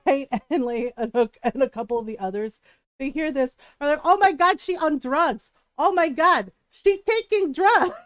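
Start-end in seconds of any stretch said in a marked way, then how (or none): 4.0-8.0 imitating scandalized Filipino titas, louder and higher; mock outrage in a tita's accent; judgy titas. Aunts gossiping that she takes drugs, judgy and loud. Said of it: "one of my more judgy titas".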